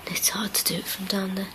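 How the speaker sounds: hushed whisper, barely voiced. Real, not acted. Ghost hunter checking the stairs, whispering. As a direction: whisper so nothing downstairs hears. Quiet ghost hunt in the house.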